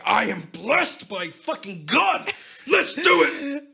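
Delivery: high, loud, slow hammed-up war cry; blessed crusader charging the battlefield; mock battle cry.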